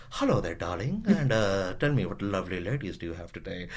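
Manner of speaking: a lightly voiced sleazy charmer, soft and low; a hint of a seedy smooth talker on the phone; seedy eloquent prank caller. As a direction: purr it like a smarmy caller, quiet and oily. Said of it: "he was really eloquent with it".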